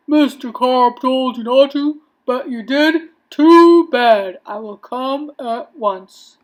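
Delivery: loud, firm officer, slowing at the end; stern policeman taking the call. A narrator's reading. Policeman to boy who overfed fish, firm and loud.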